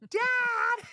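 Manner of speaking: high-pitched imitation of a kid's holler; child yelling. Son still yelling for dad from the other room, imitated high yell. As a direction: call out long and very high, like a child. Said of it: "him yelling".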